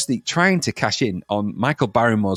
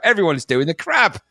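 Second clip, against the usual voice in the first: higher imitated announcer's call; mock shout-out; 6 semitones higher than usual. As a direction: call it out to a dance floor, half-strength. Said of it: "when he shouts out".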